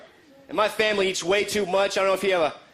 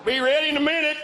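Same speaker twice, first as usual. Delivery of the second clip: high, perky little voice; eager salt shaker; 10 semitones higher than usual. Acted. Salt shaker preparing for icy roads, high perky voice. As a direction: squeak it out eagerly, higher.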